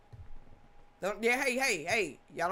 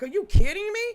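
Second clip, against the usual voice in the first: much higher and louder, in disbelief; incredulous outburst; 10 semitones higher, 10 dB louder, faster than usual. Retold or imitated. Man fumes at TikTok's warning, loud incredulous outburst. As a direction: blurt it out like you can't believe the warning. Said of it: "I'm sitting here like".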